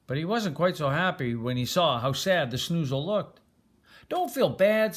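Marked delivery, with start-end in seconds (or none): 4.1-4.9 higher, gentle comfort; a light reassuring prince. Acted. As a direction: soothe the sad creature kindly. Bernard comforts sad snoozle.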